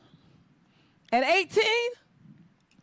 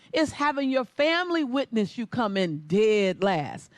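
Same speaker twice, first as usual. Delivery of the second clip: ordinary delivery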